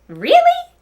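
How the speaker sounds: very high, loud squeal of surprise; shocked mommy. Acted. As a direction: shriek it in disbelief. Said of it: "asked Trixie's mommy".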